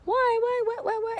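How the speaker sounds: imitating a small child's high, whiny protest; high-pitched toddler tantrum impression; mock tantrum. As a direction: whine it way up high like a kid in meltdown. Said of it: "there's this whole tantrum".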